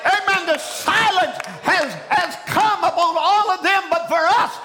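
high, driving pulpit shout; full-force preaching. Acted. Preacher telling congregation the lion silences critics, high forceful preaching. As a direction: preach it like the king's roar.

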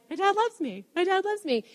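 high, soft, imitated crying voice; retold tearful wonder. Daughter recalling reading her dad's card, mock tearful. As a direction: squeak it softly, as if tearfully moved. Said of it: "reading it and crying and being like".

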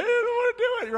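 high-pitched mock wail of a child; protesting child. Daughter to dad in the roller coaster line, high protesting wail. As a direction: imitate a scared kid balking. Imitates tearful daughter balking at ride.

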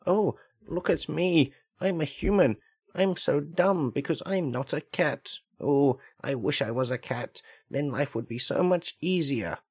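mocking dumb human. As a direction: ham up a dim-witted human. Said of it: "I hear them say things such as".

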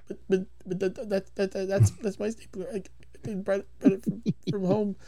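soft, stammering mumble; lightly voiced mumbling Milton. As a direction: mutter it, stammering under your breath.

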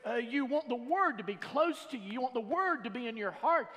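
insistent preacher, pitched high; forceful preaching. Real, not acted. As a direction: drive the point home to the congregation. Preacher drives the point home.

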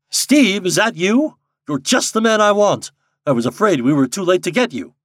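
loud, high call across to Steve. A narrator's reading. Old friend calling Steve from the hotel piazza, loud and high. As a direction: hail him from the piazza.

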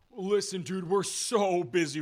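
loud, high, slow hurried official; lightly voiced busy Coast Guard; harried Coast Guard. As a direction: brush them off like an overworked officer.

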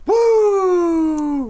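high, loud woo; excited whoop. Real, not acted. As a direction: whoop like Ric Flair. Celebrating a point.

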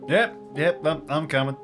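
normal talking voice

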